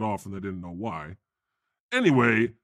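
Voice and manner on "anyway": ordinary talk, nothing marked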